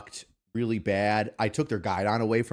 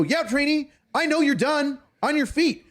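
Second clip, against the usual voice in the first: much higher, barked drill sergeant orders; 13 semitones higher than usual. Retold or imitated. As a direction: bark it like a drill sergeant mocking a recruit. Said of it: "I go".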